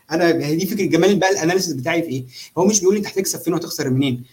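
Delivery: ordinary delivery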